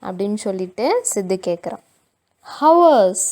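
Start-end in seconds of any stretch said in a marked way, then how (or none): 2.6-3.2 loud high wail of a word; boy overacting his pain, wailing loudly. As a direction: ham up the suffering. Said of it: "ரொம்ப over react பண்றான்".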